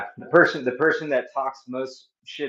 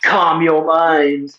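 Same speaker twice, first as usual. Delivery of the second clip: very slow loud drawn-out mantra; 4 semitones higher, 12 dB louder, much slower than usual. Pretend. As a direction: stretch it out like a guru.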